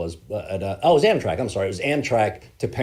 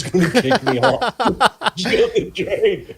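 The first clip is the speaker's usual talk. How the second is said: higher, louder, breaking into laughter; laughing; 10 semitones higher, 8 dB louder than usual. Real, not acted. Telling how they were nearly kicked off, laughing. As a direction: laugh through the words. Laughing at own near ejection.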